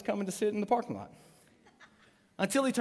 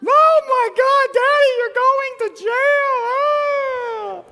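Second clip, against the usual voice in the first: hammed-up high, drawn-out scream; freaking-out daughter; 16 dB louder, much slower than usual. Daughter panicking that daddy's going to jail, mock screaming. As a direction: wail it loud and high, imitating a panicked child. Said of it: "my daughter is freaking out".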